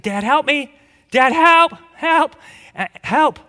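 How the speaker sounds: high, rising cries for Dad; mock panicked calling. Preacher reliving childhood merry-go-round scare calling Dad, mock panicked calling. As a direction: call out like a dangling child. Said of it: "I vividly remember that happened to me".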